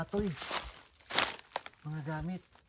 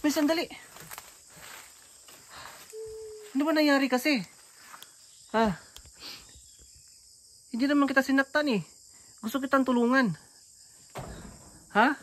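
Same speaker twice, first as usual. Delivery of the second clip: calling out; 7 semitones higher than usual. Real, not acted. Calling after angry woman.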